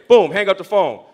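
normal talking voice

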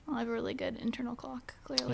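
quick, breathy whispered aside; whispering. Real, not acted. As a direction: whisper it as a smug joke. Joking boast about her timing.

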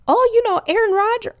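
high, loud, perky parody. Retold or imitated. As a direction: mimic chirpy polite sports chatter.

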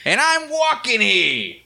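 hammed-up New York "I'm walking here"; New York accent. New York movie quote.

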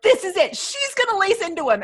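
high, keyed-up gasp of suspense; excited fan anticipation; eager anticipation. Retold or imitated. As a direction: voice the audience bracing for drama. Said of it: "all of us are like".

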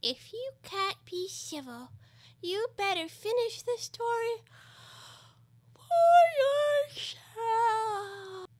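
high, dragged-out whiny sulk; petulant, slow creature voice; sulky. A narrator's reading. Dormouse threatens to stop the story, sulkily. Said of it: "sulkily remarked".

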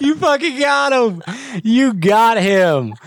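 laughing hard while talking. Real, not acted. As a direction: crack up in disbelief. Prank call just succeeded.

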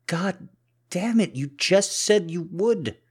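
high, loud, outraged protest; angry outburst. Acted. Cuffed man betrayed by his partner, angry. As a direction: explode in frustration. Partner breaks promise to uncuff.

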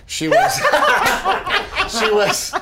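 high, loud laugh breaking the words; laughing squeal. Real, not acted. Husband describing wife without makeup, high squealing laugh.

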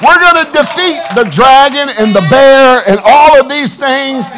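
high, loud, rousing sermon; impassioned pulpit shout, punching the key words; full-force preaching. Real, not acted. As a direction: proclaim victory to the congregation at full voice.

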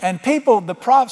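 ordinary talk

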